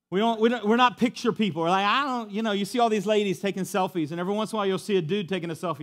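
loud, high, fervent preaching; preaching at full force. Acted.

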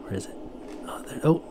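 ordinary talk

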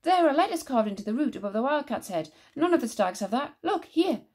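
high, excited call; urgent. A narrator's reading. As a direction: stop them urgently. Moll stops them, urgent discovery.

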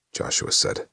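ordinary delivery, nothing marked

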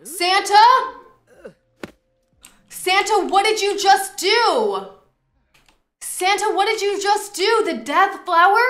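high, loud, slow shocked screams; shocked screaming. Real, not acted. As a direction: scream in disbelief at Santa. Santa kills her husband.

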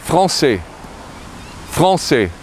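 français, said frahn-seh in French; French pronunciation. Shown as a demonstration. Teacher models français for learners, careful French. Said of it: "how to say French".

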